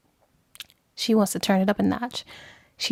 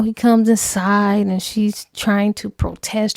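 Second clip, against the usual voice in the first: normal talking voice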